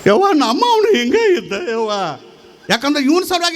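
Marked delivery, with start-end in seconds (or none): 0.1-1.9 swooping singsong wail; hammed-up, comic keening; mock lamenting. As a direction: parody a mourner crying for her own dead. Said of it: "ಇವು ಏನು ಅಳ್ತಾವ".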